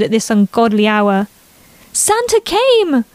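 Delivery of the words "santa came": put-on very high, slow, awestruck little girl; a kid who just saw the presents; excited child. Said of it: "Emily pointed excitedly".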